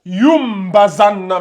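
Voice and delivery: "Yum bazanna" slow, m held before b; qalb pronunciation. Shown as a demonstration. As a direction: draw out the m in "Yum". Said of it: "comment ça se prononce". (« Yum bazanna » lentement, m tenu)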